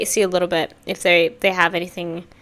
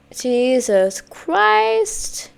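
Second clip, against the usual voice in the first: slow stretched exclamation; drawn out; 3 semitones higher, much slower than usual. Real, not acted. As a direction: stretch it out, overwhelmed. Overwhelmed reaction.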